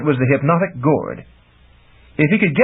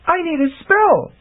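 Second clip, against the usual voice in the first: higher, lightly voiced young woman pleading; Chameleon voice. A narrator's reading. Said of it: "she said".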